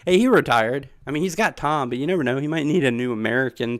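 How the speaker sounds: ordinary talk, nothing marked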